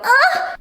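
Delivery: a sudden high yelp as if mid-stretch; high squeaky stretch yelp; mock stretching yelp. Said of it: "be stretching and go".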